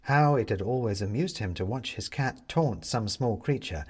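plain reading voice

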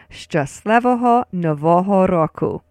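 "Szczęśliwego Nowego Roku" as shchus-LEH-vo-ho no-VO-ho RO-ku; full-phrase demo. Shown as a demonstration. Teacher puts it all together, "Szczęśliwego Nowego Roku" carefully modelled. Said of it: "we're ready to put it all together now".

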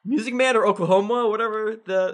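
excited. Real, not acted. Excited guess at the musical.

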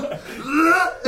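high laughing gag noise; retching gag. Retold or imitated. Plumber gagging again.

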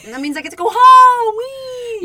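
very high, loud playful squeal; gleeful cheer. Acted. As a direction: sing out the joy of heading home, ending in a squeal. Joins in acted joy at shift end.

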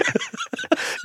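high squeak of laughter. Real, not acted. Comedian cracks up at his own story, high squeal of laughter. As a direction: let a squeal of laughter slip out.

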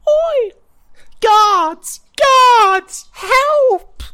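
piercing high shriek for help; child yelling for guards. Acted. Child shrieking for guards at the would-be thief. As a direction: scream for the guards as loud as you can. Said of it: "the child looks at you and goes".

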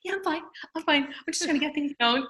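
high-pitched retold brush-off; breezy insistence. Pregnant woman to Zumba instructor, breezy high-pitched insistence.

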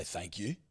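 "thank you" drawn soft and quiet; rich American thank you. Shown as a demonstration. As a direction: breathe "thank you" softly, posh American. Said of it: "the way they say".

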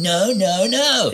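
high, rising mock wail of protest; exaggerated high-pitched denial; mock refusal. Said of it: "and John's going".

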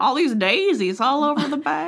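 mock country accent. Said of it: "Southern hick accent".

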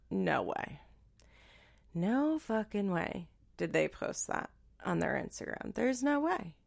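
quietly muttered, as if thinking it; under your breath, stunned; soft incredulous murmur; hushed disbelief. Retold or imitated. Stunned disbelief thought to herself.

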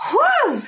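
excited exhale whoop; fired-up whew. Real, not acted. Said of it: "I just get fired up".

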